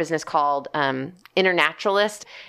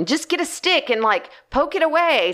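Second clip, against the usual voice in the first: mom's advice; 10 semitones higher than usual. Retold or imitated. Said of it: "my mom's over there telling me".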